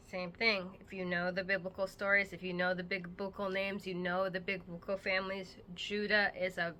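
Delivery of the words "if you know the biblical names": ordinary talk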